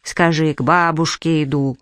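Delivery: plain reading voice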